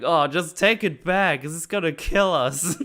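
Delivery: high, loud mock panic with laughter; panicked plea, laughing through it. Crew panicking, retold.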